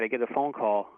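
usual speaking voice

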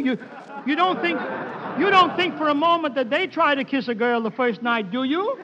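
usual speaking voice

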